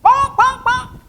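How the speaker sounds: high loud bonk bonk clucks; hen bonk noises. Acted. Chicken squawking off to the roost.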